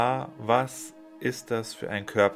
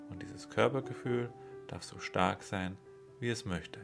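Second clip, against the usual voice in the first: the affirmation repeated much softer, as an inner voice; quiet echoed affirmation; 8 dB quieter than usual. Shown as a demonstration. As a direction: let "dieses Körpergefühl darf so stark sein" fall to a hush. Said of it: "affirmation repeated as soft echo".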